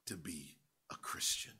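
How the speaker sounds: low, soft unvoiced whisper. Shown as a demonstration. Preacher restating Agrippa's hesitant reply, whispering. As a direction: let it fall to a whisper. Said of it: "you almost persuade me".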